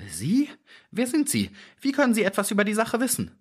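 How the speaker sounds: very high, hurried little-man voice; alarmed questioning. A narrator's reading. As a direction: question the stranger in rising panic. Startled man questions the stranger.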